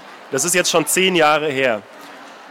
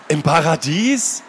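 slow, rising disbelieving question; ungläubig; incredulous; slower than usual. Acted. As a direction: blurt it out in disbelief. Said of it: "stieß Anna hervor".

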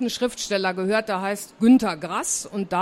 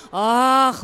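ordinary delivery

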